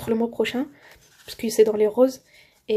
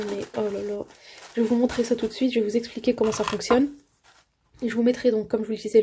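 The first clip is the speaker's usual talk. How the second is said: ordinary delivery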